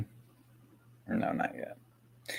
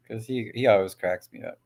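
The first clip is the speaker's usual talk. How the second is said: ordinary talk, nothing marked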